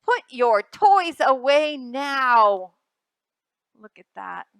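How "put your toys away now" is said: loud, slow, stern order; loud slow mother's command; firm scolding. Acted. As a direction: lay down the law to a child.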